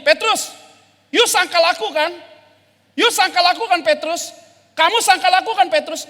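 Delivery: loud, high hammering accusation; the Jesus who did not happen accuses Peter, loud and high; accusing. Acted. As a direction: confront Peter over and over.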